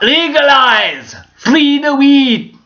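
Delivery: long, high Jamaican cry; hammed-up Jamaican rallying shout; Rasta voice. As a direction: stretch each word out in a loud Rasta chant. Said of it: "that's from my Rasta voice".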